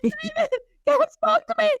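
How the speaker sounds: very high, squeaky urgent wake-up call; acted-out breathless rush to share huge news; frantic excitement. Wife waking sleeping husband after God spoke, frantic excitement. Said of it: "I'm like".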